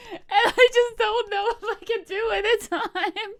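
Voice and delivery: laughing it out, high-pitched. Real, not acted. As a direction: giggle through the whole line. Laughing at her own tight deadline.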